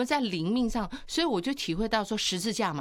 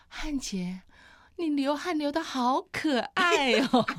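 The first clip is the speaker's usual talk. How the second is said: slow, higher, doting tone; a tender compliment, drawn out and sweet; sweet-talking husband; 6 semitones higher, 3 dB louder, slower than usual. Retold or imitated. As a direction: coo it at him lovingly. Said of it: "我这辈子不可能说的话".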